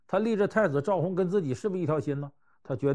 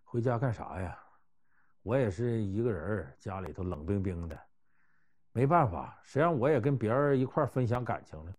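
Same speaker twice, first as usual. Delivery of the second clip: mock sigh; 5 semitones lower, 4 dB quieter than usual. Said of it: "也唉声叹气".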